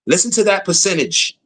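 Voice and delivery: normal talking voice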